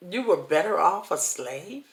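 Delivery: ordinary talk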